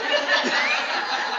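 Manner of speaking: a high, breathy burst of laughter. Real, not acted. Laughing at locking the kids in the house. Laughing at her own joke.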